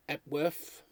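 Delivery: ordinary talk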